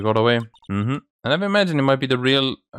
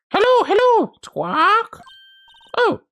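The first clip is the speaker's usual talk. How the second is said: high squawky parrot voice, much higher and louder; 3 dB louder, slower than usual. Acted. Voicing the parrot Polly.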